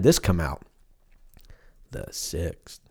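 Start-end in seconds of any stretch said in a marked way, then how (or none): 1.9-2.8 whispered aside. Real, not acted. Muttered aside.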